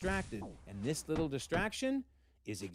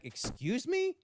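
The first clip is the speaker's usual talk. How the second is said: indignant; 12 semitones higher, slower than usual. Acted. Aunt Anna's shocked reaction.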